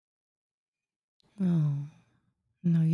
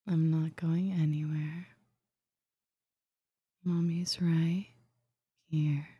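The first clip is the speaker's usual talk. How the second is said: soothing whisper. Acted. Calming the listener, shushing.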